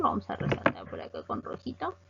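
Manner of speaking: normal talking voice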